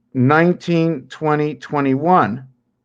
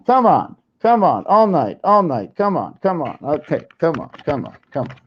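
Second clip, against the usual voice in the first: coaxing a pet. Real, not acted. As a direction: coax the pet, trailing off.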